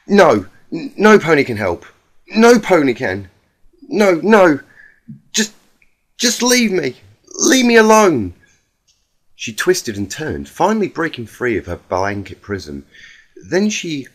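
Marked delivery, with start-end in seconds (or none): 0.1-8.3 loud, high despairing cry; distraught shouting. Acted. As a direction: push everyone away in despair, much louder. Rainbow Dash distraught, refusing help.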